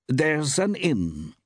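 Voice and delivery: high, slow woman's voice; shopkeeper woman. A narrator's reading. Woman shopkeeper tells a traveller of an inn, slowly. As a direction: offer it slowly, pitched high.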